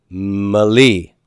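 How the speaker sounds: "Mali." set apart after a break; drawn-out teaching demo of "Mali."; syllable division. Shown as a demonstration. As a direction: say "Mali." slowly as the separate second part. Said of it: "divide right in between them".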